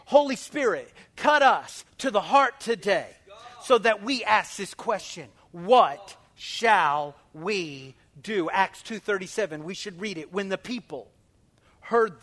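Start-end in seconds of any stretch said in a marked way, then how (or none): 0.0-8.5 loud prayer, final question very slow; fervent prayer. Acted. Pastor praying over the church, fervent. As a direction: pray loudly, then drag What shall we do.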